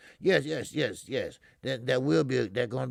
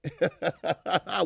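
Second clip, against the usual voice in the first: real rapid chuckle, higher in pitch; laugh; 4 semitones higher, 3 dB louder than usual. Real, not acted. Host reacting to an easy-work boast, laughing. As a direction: burst into a rolling chuckle.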